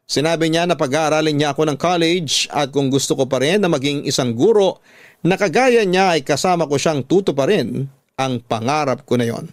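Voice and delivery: normal talking voice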